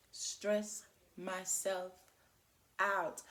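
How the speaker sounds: "stress myself out" very slow, a pause before "out"; word-by-word emphasis. Real, not acted. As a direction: stretch and separate each word of "stress myself out". Spells out her urge word by word.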